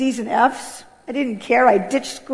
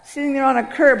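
normal talking voice

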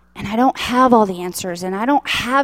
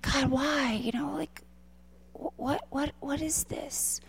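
soft whispered plea, almost no voice; tearful whisper; 3 semitones higher, 13 dB quieter than usual. Retold or imitated. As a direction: breathe the question out through tears. Tearful prayer retold.

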